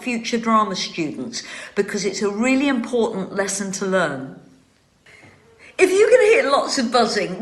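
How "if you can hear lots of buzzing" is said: breaks into a laugh, higher and louder. Real, not acted. Vlogger laughing to viewers about the buzzing bees. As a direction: chuckle through the opening words. Amused aside about bee noise.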